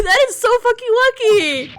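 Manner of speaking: high-pitched excited outburst; astonished, voice shooting up; real disbelief. Real, not acted. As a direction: exclaim it, floored by the twist. Reacting to shock plot twist.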